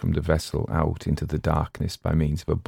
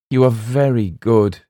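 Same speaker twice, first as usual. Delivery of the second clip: raised, louder heartfelt thanks; higher, fervent exclamation; exclaiming; 5 semitones higher, 6 dB louder than usual. A narrator's reading. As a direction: exclaim your gratitude across the water. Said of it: "exclaimed the voice".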